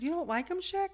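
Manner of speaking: surprised high rising question. Retold or imitated. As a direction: ask in shocked disbelief.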